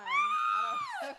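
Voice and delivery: high, loud, slow teasing yell; shrieking I don't know through laughter; laughing shout. Real, not acted. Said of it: "five one".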